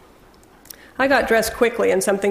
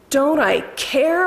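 slow, higher, stretched-out indignant holler; 6 semitones higher, slower than usual. Acted. Husband hollering back at his ex-wife, indignant. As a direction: throw her words back at her, slowly. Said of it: "my husband hollered".

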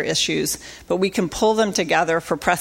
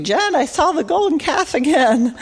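put-on mom's Southern accent, pitched high; 9 semitones higher, 3 dB louder than usual. Daughter imitates her Southern mom on the phone, Southern accent. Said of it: "She's got a Southern accent, so I have to do the Southern accent".

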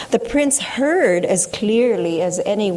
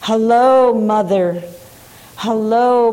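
shouting hello; 3 semitones higher, 5 dB louder than usual. A narrator's reading. Men shouting greetings down the hole to mother, loud. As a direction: call out to faraway mother. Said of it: "they shouted".

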